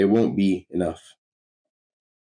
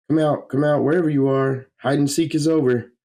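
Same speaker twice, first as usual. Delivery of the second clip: put-on sing-song, higher, coaxing a hidden child; playful coaxing; 8 semitones higher, 3 dB louder than usual. Hero coaxing a frightened child from hiding, playful and higher. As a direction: call out gently like a game of hide and seek.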